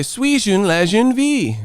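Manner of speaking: high, slow mock-French kid; little kid imitation. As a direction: sing out fake French like a child. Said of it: "The little kid look at the screen and say".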